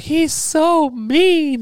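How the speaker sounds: high, drawn-out mock wail; retold bawling. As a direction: imitate a tearful child's protest, high and stretched.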